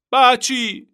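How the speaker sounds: very high, loud tearful cry; crying shout. A narrator's reading. Boy crying out to the Turk for his horse. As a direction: call after the man through tears. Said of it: "kiáltotta sírva".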